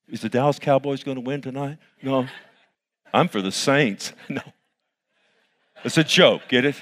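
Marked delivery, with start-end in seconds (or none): none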